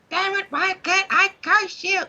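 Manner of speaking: very high squeaky cartoon voice; Danhausen impression. Imitating Danhausen to a friend, squeaky and high. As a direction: squeak it out like a frustrated imp. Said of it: "That was a great Danhausen".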